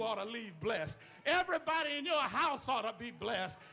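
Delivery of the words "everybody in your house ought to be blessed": rising, louder preacher's cry building to a shout; preaching at full force. Acted. Preacher shouting to the congregation to worship for others.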